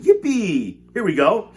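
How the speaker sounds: high, loud whoop of joy; gleeful shout. A narrator's reading. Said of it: "shouted Grandpa Joe again".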